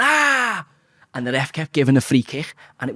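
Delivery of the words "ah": high yelped "Ah!" like a diving footballer; fake pained cry. Footballer diving to win a free kick, mock yelp. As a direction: squeal as if fouled, hamming it up. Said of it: "diving on the floor and going".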